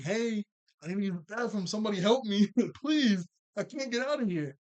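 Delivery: hammed-up trapped dad begging; mock panicked plea. Jack voiced trapped in the chamber, mock pleading to be let out. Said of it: "banging on the wall, like".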